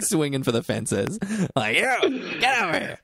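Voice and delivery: put-on high drunken bellow; drunk angry mogul. Imagined drunk Monopoly guy hollering at someone, mock bellow. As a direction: holler like a drunk, pissed-off tycoon.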